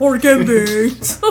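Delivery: slow, drawn-out quote; lightly voiced slow movie line; movie character impression. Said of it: "From Something About Mary?".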